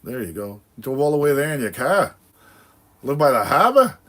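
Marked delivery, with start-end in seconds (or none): 3.0-4.0 put-on Rhode Island accent. Teasing a Rhode Island viewer, mock Rhode Island accent. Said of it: "I was talking like that one day".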